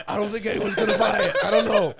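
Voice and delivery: laughing. Retold or imitated. Kid voiced, laughing.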